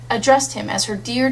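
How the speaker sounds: ordinary delivery, nothing marked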